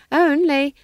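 high, loud character voice; old witch excusing herself. A narrator's reading. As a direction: protest cheekily from the chains. Nanny speaks from her chains.

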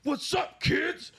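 high, hammed-up yell at the kids; mock prisoner yelling. Prisoner screaming at scared kids, hammed-up high yell. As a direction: play a prisoner showing off for cameras. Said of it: "screaming at the kids".

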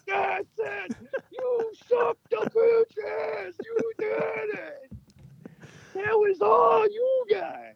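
very high, slow screaming celebration; gloating Yankees fan. Retold or imitated. Yankees fan screaming over his friend's loss, high shout. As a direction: shriek in triumph like a rabid fan. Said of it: "I'm totally gonna be a Yankees fan about it".

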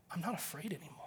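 whispered, barely voiced. Son telling dad he is not afraid, in a whisper. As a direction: breathe it out like a secret. Son's tearful confession.

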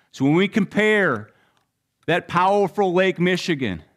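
ordinary talk, nothing marked

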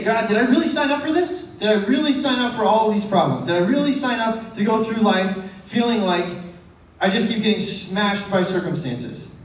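high, pleading whine up at God; whiny plea to God. Retold or imitated. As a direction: complain to God like a wronged kid.

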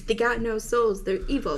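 ordinary delivery

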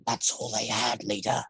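quiet, breathy alien growl; soft menacing alien voice. Acted. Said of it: "the voice was kind of".

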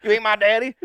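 high, loud put-on retort; defiant mock shout. Boy rebuffing the old man, defiant mock shout. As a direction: holler it back as the defiant boy.

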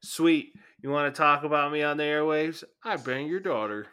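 mock taunting retort. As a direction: throw it back like a taunt. Imagined retort to the radio host.

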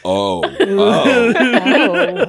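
escalating shocked gasps; rising alarm. Pretend. Cammy reading the letter, building shocked oh. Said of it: "it's just like".

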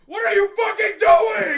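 high, very loud, drawn-out scream; hammed-up screamed reproach; retold alarmed yell. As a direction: yell at a reckless driver. Acts out passenger's panicked yell.

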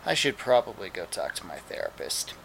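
low, very soft voiced quote; subdued aside. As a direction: say it low and small, as if to oneself. Deadpan line voiced for departing character.

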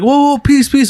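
high, loud mock surrender; imitated panicked plea. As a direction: beg for a truce, hammed-up. Acts out enemy begging for truce.

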